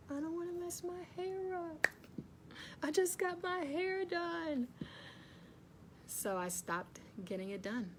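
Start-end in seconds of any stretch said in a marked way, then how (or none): 0.1-4.7 mock her past self fussing over hair; her old excuse. Mimicking her past self protecting fresh hair, whiny. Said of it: "And I did that for many years".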